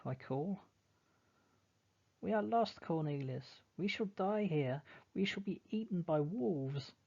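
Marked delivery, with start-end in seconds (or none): 2.2-6.9 high, frightened wailing; wailing girl. Acted. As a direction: wail in terror of wolves. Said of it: "She starts to wail once more".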